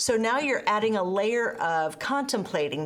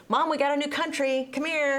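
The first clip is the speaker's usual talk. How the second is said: put-on higher, eager child calling out; excited kid; 6 semitones higher than usual. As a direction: call Mom like a thrilled kid. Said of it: "and be like".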